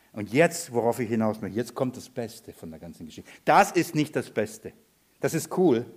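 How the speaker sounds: ordinary talk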